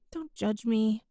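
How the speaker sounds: usual speaking voice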